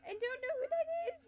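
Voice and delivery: high, loud giggly voice. Real, not acted. Laughing at a name from chat, high and giggly.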